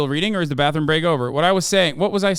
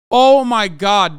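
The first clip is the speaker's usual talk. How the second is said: slow, loud, disbelieving groan; exasperated; 4 semitones higher, 7 dB louder, much slower than usual. Real, not acted. Reacting to the billionaire's pettiness, loud slow exasperation. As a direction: drag it out like you can't believe it.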